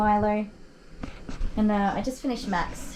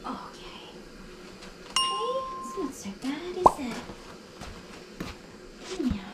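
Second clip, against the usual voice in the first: anxious doggy voice; 8 semitones higher, 6 dB quieter than usual. Real, not acted. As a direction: comfort a frightened dog. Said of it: "I talk in my anxious doggy voice".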